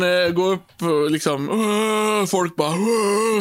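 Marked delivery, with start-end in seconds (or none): 1.5-2.2 put-on long, raised "whoo" of a drunk crowd; drawn-out crowd holler, higher pitched; imitated drunken crowd roar. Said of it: "fulla och, och skriker". 2.8-3.4 put-on long, raised "whoo" of a drunk crowd; drawn-out crowd holler, higher pitched; imitated drunken crowd roar. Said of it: "fulla och, och skriker".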